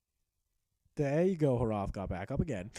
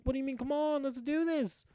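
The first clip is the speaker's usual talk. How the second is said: pitched much higher, pleading; impatient Hrothgar; 8 semitones higher than usual. Retold or imitated. Hrothgar urging the unmoving invader to fight, high and impatient. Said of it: "So Hrothgar was like".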